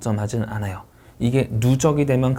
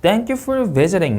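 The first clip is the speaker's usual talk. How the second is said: slow, higher THANK YOU FOR VISITING in one breath group; linked phrase reading; 7 semitones higher, much slower than usual. Shown as a demonstration. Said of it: "묶어 읽을 수".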